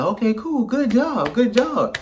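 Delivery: high-pitched mimicked voice handing out praise; imitated praise. Game host praising the player's answer, in an imitated high voice.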